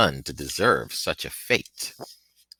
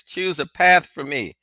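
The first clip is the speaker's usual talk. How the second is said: high, loud plea through tears; a desperate prayer, raised high and loud; tearful cry; 11 semitones higher, 5 dB louder than usual. A narrator's reading. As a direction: cry out to God, begging for help. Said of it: "I cried aloud, my eyes streaming hot tears".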